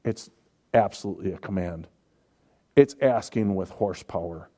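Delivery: normal talking voice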